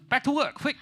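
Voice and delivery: high, loud hurried command; frantic cook's shout; barked order. Acted. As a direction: drive the kitchen on urgently. Acts frantic Chinese cook.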